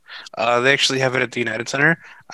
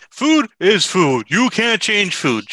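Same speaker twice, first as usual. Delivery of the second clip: angry Bart impression; 8 semitones higher, slower than usual. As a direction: growl it slowly like an annoyed friend. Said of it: "he's gonna say this angrily".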